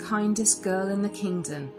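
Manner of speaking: ordinary delivery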